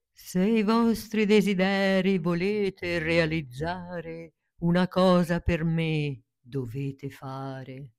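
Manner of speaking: slow, solemn, rhyming wizard's decree. Acted. Wizard of Oz to Dorothy and friends, slow solemn decree. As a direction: intone it like a grand magician.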